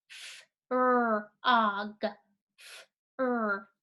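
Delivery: "F-, r-, og" split into separate sounds; sounding out frog. Shown as a demonstration. Teacher to young readers, segmenting the word sound by sound. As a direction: pull apart each sound of "F-, r-, og", slowly. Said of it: "What two sounds do you hear".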